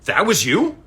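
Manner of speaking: high, loud rising question; amazed, voice jumping up; surprised. Retold or imitated. Friend realizing who it was, loud high surprise. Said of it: "And he goes".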